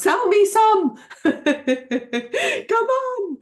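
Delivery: playful shout to a viewer to sell her oils; calling out playfully. Real, not acted. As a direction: call across the chat to Catherine. Calling out to Catherine.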